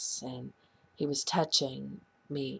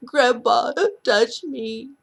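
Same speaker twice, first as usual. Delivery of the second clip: put-on loud, high child's wail; slow, sobbing cry, acting a little girl; imitated bawling; 9 semitones higher, 9 dB louder, slower than usual. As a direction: wail it through tears as the niece. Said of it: "she's bawling".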